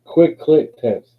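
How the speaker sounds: slow, high, each word punched; high, loud tongue-twister drill; loud deliberate enunciation. Shown as a demonstration. Practising tongue twister loudly.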